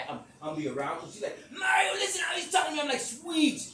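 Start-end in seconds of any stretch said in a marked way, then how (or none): 1.5-3.0 high, loud wail of protest; retold upset complaint. Woman complaining to Mario about him, loud and upset. 3.0-3.6 high, loud exasperated cry; retold protest. Speaker protesting to Sweet, loud and exasperated.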